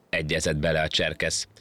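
usual speaking voice

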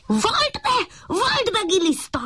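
very high puppy voice, accusing; puppy shouting. A narrator's reading. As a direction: yell at the worm like a small dog. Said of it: "kiabált a kiskutya". (magas hangú, kiabáló kiskutya)

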